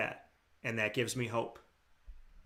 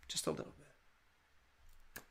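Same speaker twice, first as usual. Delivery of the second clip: breathy near-silent whisper; 19 dB quieter, much slower than usual. Real, not acted. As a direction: drop to a hushed whisper on the last words. Soft closing emphasis on hope.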